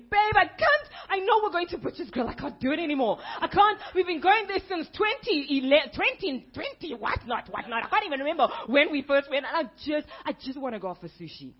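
loud, high, laughing rant; outburst. Retold or imitated. Wife to husband, bursting out about the steakhouse. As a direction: blurt it all out, half laughing. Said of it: "I just let it out".

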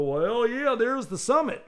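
high, loud Southern drawl on yeah and summit; southern accent. Retold or imitated. Southern hiker at the summit, loud Southern accent. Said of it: "a guy with a southern accent go".

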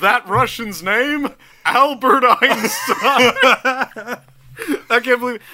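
slow, laughing through the words. Real, not acted. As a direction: drag it out while laughing. Laughing joke aside.

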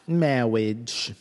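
drawn-out speech-impeded clergyman; Princess Bride priest. Acted. Riffing on the word marriage, mock priest voice.

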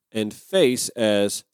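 ordinary talk

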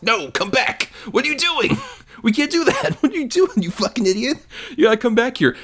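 high-pitched mock yell, laughing; half-laughing imitation of a panicked buddy; frantic calling back. Acting out buddy calling Bronson back.

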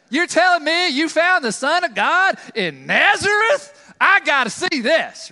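loud, slow, scoffing disbelief; incredulous scoff. Retold or imitated. Nathanael scoffing at the news from Nazareth, loud and incredulous. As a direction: sneer at the idea like a doubter. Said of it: "That redneck podunk place".

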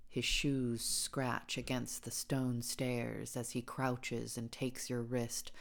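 ordinary delivery, nothing marked

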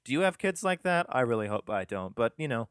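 usual speaking voice

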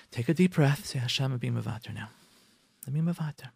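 soft, low murmured prayer; steady yourself under your breath, softly; calming self-talk. Pretend. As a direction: take it quietly, almost to yourself. Voices wife's private prayer.

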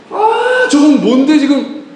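high-pitched mock indignation; envious outburst. Failed student hearing of cousin's admission, envious outburst. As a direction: burst out high, acting jealous and put out. Acted envy at cousin's success.

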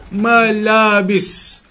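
Malabis drawn out very slowly: ma-la-bis; slowly spelled-out word. Shown as a demonstration. Teacher repeating Malabis slowly so the class hears the sound. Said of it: "the sound of the word".

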